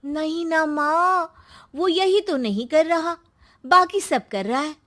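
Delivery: put-on a young daughter spreading gossip to mother; sly daughter whispering a secret to mom; Srishti's scheming voice.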